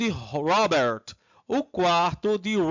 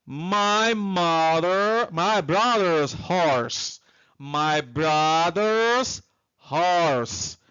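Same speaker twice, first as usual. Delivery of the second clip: drawn-out, careful teacher's model; slow, stretched-out English answer; slow model pronunciation; much slower than usual. Shown as a demonstration. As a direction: say it slowly for students to copy.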